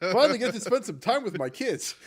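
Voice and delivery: high, loud, joking retiree voice; relieved old king. Retold or imitated. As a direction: play the king happy to retire. Continues the Greymane quote.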